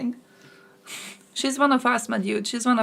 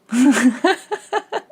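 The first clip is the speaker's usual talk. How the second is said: loud high laugh; 5 semitones higher, 7 dB louder than usual. Real, not acted. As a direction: burst of high-pitched laughter. Reaction to news, loud laugh.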